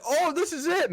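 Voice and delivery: excited. Retold or imitated. Friends finally hooking target fish.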